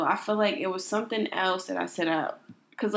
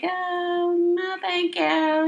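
polite brush-off; 10 semitones higher, much slower than usual. Retold or imitated. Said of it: "I be like".

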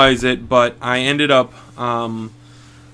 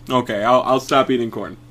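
ordinary talk, nothing marked

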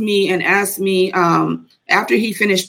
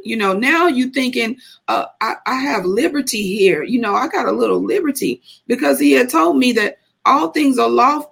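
ordinary talk, nothing marked

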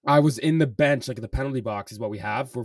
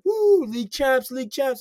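mock celebration; 12 semitones higher than usual. Taunting rival fans after winning.

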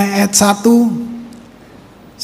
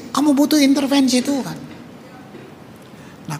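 ordinary delivery

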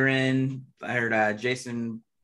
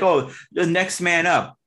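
mock fan quote; 7 semitones higher, 7 dB louder, slower than usual. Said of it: "people are like".

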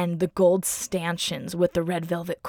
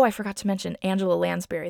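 ordinary delivery

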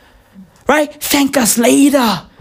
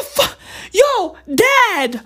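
son's voice; 10 semitones higher, slower than usual. Retold or imitated. A father mimics his young son in a high, laughing voice. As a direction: squeak it out like a startled little boy. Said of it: "my son is like".

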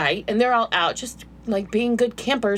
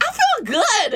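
mock tipsy high voice; 12 semitones higher, slower than usual. Drunk self, high.

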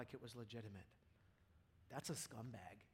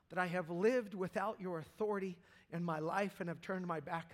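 high, loud impassioned preaching; preaching at full force; 5 semitones higher, 11 dB louder than usual. Acted. Preacher crying out repentance to God before congregation, impassioned. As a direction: pour out a broken confession at full volume.

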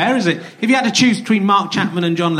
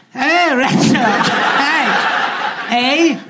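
higher, lighter Canadian put-on, lots of heys; mock Canadian accent; 3 semitones higher, slower than usual. Comic mock Canadian greeting Russell from the crowd. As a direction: play a goofy Canadian calling out to Russell. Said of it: "I've changed the accent".

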